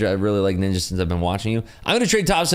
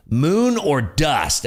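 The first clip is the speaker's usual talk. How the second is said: slow, higher, drawn out; imitated friend; 6 semitones higher, much slower than usual. Friend repeating his answer, slow mock. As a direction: stretch it out, dead serious. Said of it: "He goes".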